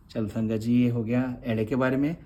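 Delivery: usual speaking voice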